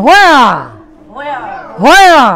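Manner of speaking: "where" as "hwe-a", said singly for repeating; where with slight h. Shown as a demonstration. Teacher introduces the next question word, "where" with a slight h. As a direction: open "where" with a light h before the w. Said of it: "এইসের একটু উচ্চারণ করতে হয়".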